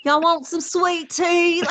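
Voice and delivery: high, slow, exaggerated Southern drawl; hammed-up Southern accent. Mocking her Southern accent for a work meeting. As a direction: ham up a Southern belle offering sweet tea. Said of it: "I have a different voice".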